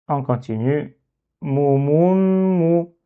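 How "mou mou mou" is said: "Mou, mou, mou" with a long m before the last, higher "mou"; shadda reading. Shown as a demonstration. Teacher reading the word, "Mou, mou, mou" with doubled m. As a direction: draw out the middle "mou" onto a held m. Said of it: "il faut rétracter les lèvres".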